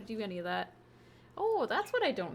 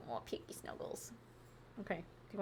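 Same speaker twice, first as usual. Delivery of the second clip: whisper; 2 semitones lower, 13 dB quieter than usual. Real, not acted. Cuddling the guinea pig.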